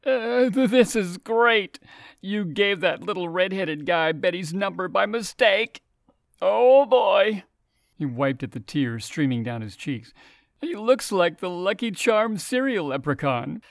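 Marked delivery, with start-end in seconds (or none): 0.0-7.4 high, breathless words through laughter, louder at the end; laughing hard. Acted. Roger laughing till tears. 10.6-13.6 high, gleeful taunt ending in a laugh; mocking laughter.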